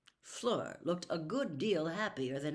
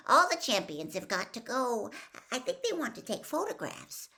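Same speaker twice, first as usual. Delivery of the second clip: put-on higher, louder nervous boy; 7 semitones higher, 4 dB louder than usual. Schoolboy pleading with the stern teacher, nervous and high. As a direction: blurt it out, anxious to be heard. Colin continues.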